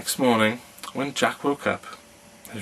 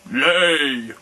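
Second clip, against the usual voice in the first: louder, higher thundering command; 4 semitones higher, 6 dB louder than usual. A narrator's reading. Giant orders egg after egg in a thundering voice. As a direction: thunder the word at the hen. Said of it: "in a voice of thunder".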